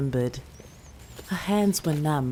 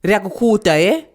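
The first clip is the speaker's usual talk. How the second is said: louder, higher teasing threat; stern grandmother; 7 semitones higher, 10 dB louder, faster than usual. Acted. Grandmother threatening to cut the girl's hair. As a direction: threaten her playfully, firmly.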